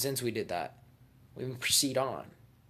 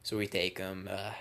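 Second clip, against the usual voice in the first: ordinary talk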